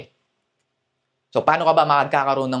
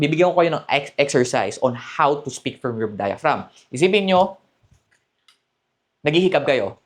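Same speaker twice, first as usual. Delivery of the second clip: ordinary talk, nothing marked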